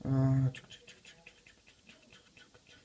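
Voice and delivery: whispered tuc tuc like a clock ticking; soft mouth-clock ticking while thinking; ticking clock noise. Real, not acted. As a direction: tick off the seconds under your breath. Thinking aloud before answering.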